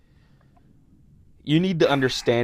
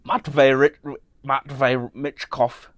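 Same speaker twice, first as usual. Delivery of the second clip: slow, attempted Russian accent; slower than usual. Shown as a demonstration. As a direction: try the name in a thick Russian accent. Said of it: "can I do a Russian accent?".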